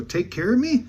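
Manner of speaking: high, rising echo question; puzzled echo. Retold or imitated. Teller checking what her offer meant, puzzled echo question. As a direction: repeat it back in disbelief, pitch climbing.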